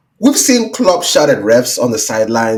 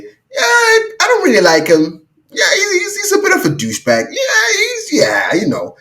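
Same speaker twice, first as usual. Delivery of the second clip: much higher, mock sobbing whine; mock whiny referee. Mocking the referee's complaint about Klopp, hammed-up sobbing whine. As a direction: whimper it like a sulky ref. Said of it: "is he not allowed to have an opinion and say".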